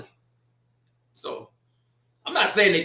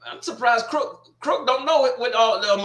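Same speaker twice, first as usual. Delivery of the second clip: usual speaking voice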